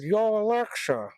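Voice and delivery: high, slurred mock caller; imitating Alexa user. Retelling someone in the shop asking Alexa, slurred mock voice. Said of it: "They said".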